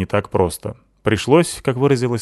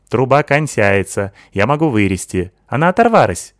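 put-on higher, lisping foreign girl voice, excited; Sykil's accented voice; 3 semitones higher, 5 dB louder than usual. Sykil praising Natasha, lisping accented girl voice.

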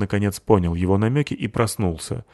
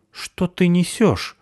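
surprised; 10 semitones higher, slower than usual. A narrator's reading. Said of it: "удивился ёж".

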